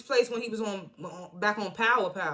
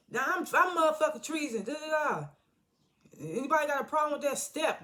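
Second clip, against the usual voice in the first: higher-pitched mock yell without extra volume; retold yelling; 6 semitones higher than usual. Janard challenging his crew, mock yelling. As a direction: imitate a defiant yell, pitched well up. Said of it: "Janard get to yelling".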